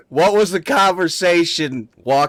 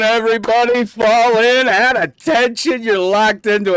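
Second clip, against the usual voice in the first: barked military command, higher and louder; drill sergeant; 8 semitones higher, 5 dB louder than usual. Pretend. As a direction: call the troops to attention. Host opens show army-style.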